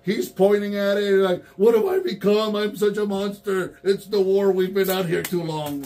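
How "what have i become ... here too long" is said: hammed-up tormented soldier. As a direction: play the tortured warrior, over the top. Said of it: "we were enacting the Robot Chicken skit".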